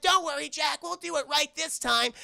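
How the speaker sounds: lightly voiced little troublemakers; trick-or-treaters' chorus. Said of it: "They're like".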